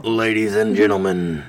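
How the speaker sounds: deep, low announcer voice; low mock showman introduction. Shown as a demonstration. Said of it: "What if I went deeper?".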